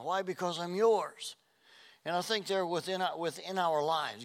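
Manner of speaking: full-force sermon climax; fervent preaching. Acted. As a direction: preach it with rising fervor.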